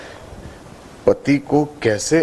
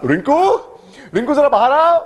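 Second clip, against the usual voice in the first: high, carrying call; 7 semitones higher than usual. Acted. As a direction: summon your wife from the next room. Husband calls wife from other room. (ऊँचे सुर में पुकारना)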